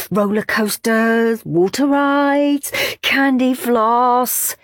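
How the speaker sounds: usual speaking voice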